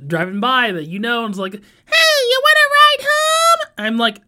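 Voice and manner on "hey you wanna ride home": very high put-on voice; high-pitched holler from a car; driver calling out. Driver offering a pedestrian a ride, calling out.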